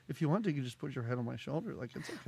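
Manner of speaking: low, soft and fast, almost whispered; hushed creepy come-on. Retold or imitated. Hosts mocking Sly's line to the kid, low hushed come-on. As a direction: breathe it like a sleazy invitation, barely voiced. Imitating Sly offering shoulder.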